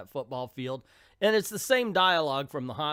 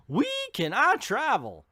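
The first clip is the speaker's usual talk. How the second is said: quoted in a higher, louder mocking tone; mock hero line; 9 semitones higher, 5 dB louder than usual. Reviewer mocking the Flash's first words, higher. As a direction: ham up "We can I travel?" with a high, bright lilt. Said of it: "the first words we hear out of his mouth".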